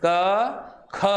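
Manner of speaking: unaspirated क, then ख with a puff of air; aspiration demo. Shown as a demonstration. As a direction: sound out क and breathy-released ख separately. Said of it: "उच्चारण करके देख".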